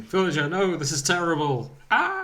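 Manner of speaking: mock panic rising to a high "Ah!"; panicked yelling. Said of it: "Blathers is just panicking and yelling".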